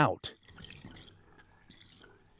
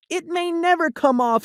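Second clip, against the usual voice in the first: worried Sally; 10 semitones higher than usual. Acted. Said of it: "Sally said".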